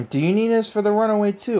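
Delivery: normal talking voice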